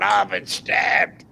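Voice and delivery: hammed-up stabbed drunk calling for help; mock panicked cry. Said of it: "he's going".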